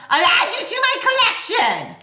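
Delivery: high, loud menacing threat; snarling threat. A narrator's reading. Medusa threatening Grover, snarling. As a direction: threaten him with venom. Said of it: "she snarled".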